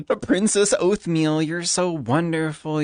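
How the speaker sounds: high swooning mock fanboy; doting admirer. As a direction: coo it like a smitten fan.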